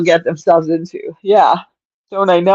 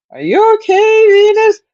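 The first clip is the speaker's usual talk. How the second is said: much higher, louder call to the cat; 4 dB louder than usual. Retold or imitated. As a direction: call out anxiously for the kitty. Calling cat again, more worried.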